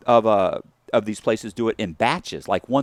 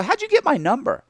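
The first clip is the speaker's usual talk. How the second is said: startled question; 5 dB louder than usual. Retold or imitated. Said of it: "I go".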